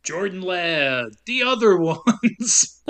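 slow announcer drawl; movie phone announcer. Acted. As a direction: keep the phone menu voice.

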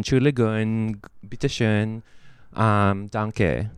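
put-on playful German accent on German words. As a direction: toss off German phrases with a German accent. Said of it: "Was that with a German accent?".

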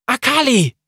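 high, loud urgent yell; warning shout. A narrator's reading. Sarah warning Akali of the collapsing wall, loudly yelling.

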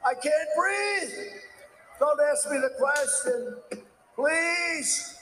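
mocking a choking rival. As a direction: whine like a debater who froze on stage. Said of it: "He's another one who's going".

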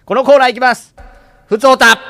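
loud, punchy title call. Acted. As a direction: announce the corner with flair. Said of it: "タイトルコール".

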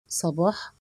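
low, hushed astonished question; understated disbelief, softly rising; stunned. A narrator's reading. Husband hearing the maid's name, quietly stunned. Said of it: "وقلت بذهول".

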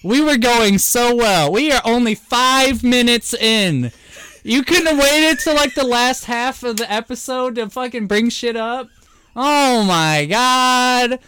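high, drawn-out outraged complaint; worked-up rant climbing in pitch; heated exasperation. Real, not acted. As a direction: wail in exasperation, stretching the last words. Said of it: "I'm fucking heated".

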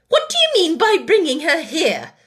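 loud, high, outraged demand; indignant scolding. A narrator's reading. Headmistress challenges the child about her doll, indignantly.

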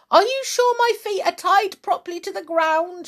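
high, fast, anxious gasp. A narrator's reading. Wife fearing the strings will break, high, fast and anxious. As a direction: rush it out in a nervous panic. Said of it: "she gasped".

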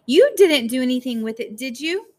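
high, loud accusing question; strange, shrill voice. A narrator's reading. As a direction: question the child sharply and shrilly. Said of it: "her voice strange and shrill".

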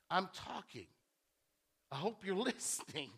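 ordinary talk

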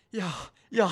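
put-on loud, high German-accented "ja"; hammed-up German accent. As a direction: bark a mock German "ja, ja". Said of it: "she sounds really German".

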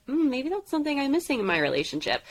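imitating a woman's dawning thought; retold musing. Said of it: "she was like".

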